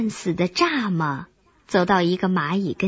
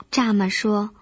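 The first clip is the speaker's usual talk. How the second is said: usual speaking voice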